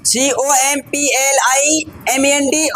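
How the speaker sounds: slow, separated letters for learners to copy; spelled out. Shown as a demonstration. Teacher showing students a one-letter spelling difference, slowly spelled out. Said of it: "सिर्फ आई का अंतर है".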